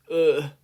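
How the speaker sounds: loud, high groan of disgust; sickened groan at the needle; queasy ugh. A narrator's reading. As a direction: gag on it. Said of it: "looking green".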